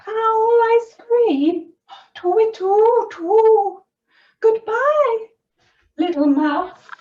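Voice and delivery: panicked owl. Acted. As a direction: owl squawks in fright. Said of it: "said the owl".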